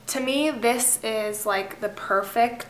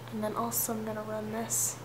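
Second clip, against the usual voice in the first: whispering; 9 dB quieter than usual. Real, not acted. Whispered aside while applying.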